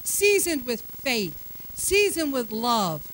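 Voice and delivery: slow, weighted seasoned with faith, seasoned with love; slow emphasis. Shown as a demonstration. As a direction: lean on each "seasoned". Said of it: "watch your words".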